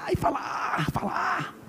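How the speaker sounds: strained voiceless cry of pain; half-whispered anguished "ai, falar". Pretend. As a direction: groan out the hurt of confessing. Mock pain of confessing.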